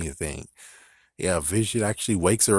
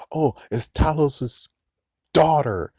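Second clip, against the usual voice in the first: mocking a fan; 7 semitones higher, 5 dB louder than usual. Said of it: "for someone who is like".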